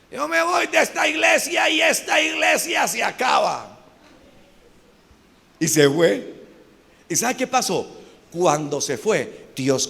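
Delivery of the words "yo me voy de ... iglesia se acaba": high, put-on threat of walking out; indignant church member. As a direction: mimic a brother's self-important outburst, high-pitched. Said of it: "Una vez un hermano me dijo eso".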